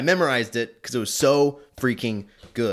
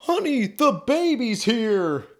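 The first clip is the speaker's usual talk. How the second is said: higher, louder, slow mock dad announcing the baby; excited dad; 13 semitones higher, 4 dB louder, slower than usual. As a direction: play the thrilled new father. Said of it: "The dad opens the door".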